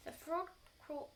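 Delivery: plain reading voice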